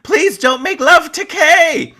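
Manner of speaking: high, drawn-out mock scream; loud retold scream. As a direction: cry out loudly, imitating a desperate plea. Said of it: "she starts to scream loudly".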